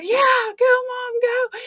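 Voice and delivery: high, excited cheering; root for mom like kids at a fight. Retold or imitated. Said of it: "me and my brother's out there".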